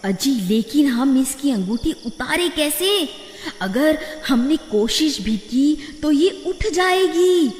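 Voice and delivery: high woman's voice, hushed worry; worried wife. Acted. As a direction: fret about waking her. Wife character voice.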